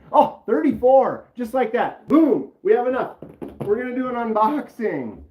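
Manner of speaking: Boom, said with a loud triumphant burst; excited cheer. Real, not acted. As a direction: celebrate the sudden jump in viewers. Viewer count jumps, celebrates.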